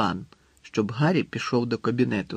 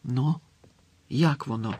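ordinary delivery

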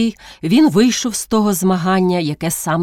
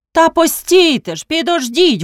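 high, pleading cry to stop; urgent call; 9 semitones higher, 3 dB louder than usual. A narrator's reading. Karpo calls out to the village meeting, urgent and raised. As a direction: call out over the crowd to hold on. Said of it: "гука знову Карпо".

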